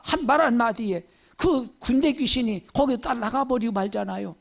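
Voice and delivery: ordinary delivery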